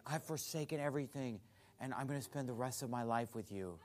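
a hushed hint of the odd visitor's vow; muted imitation of a strange devotee; quiet mock devotion. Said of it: "he's just like".

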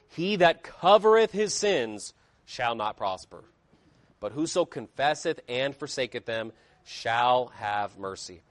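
slow, grave reading of the proverb; scripture reading. Acted. Preacher reads Proverbs to the congregation, grave scripture reading. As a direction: let the verse land slowly.

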